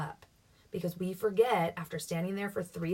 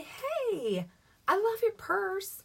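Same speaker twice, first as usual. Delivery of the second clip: gushing compliment; 13 semitones higher, much slower than usual. Shown as a demonstration. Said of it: "So you say".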